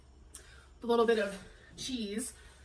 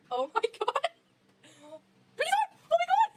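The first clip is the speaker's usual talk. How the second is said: very high, excited cries at the dog; squealing delight. Real, not acted. Reacting to zooming dog.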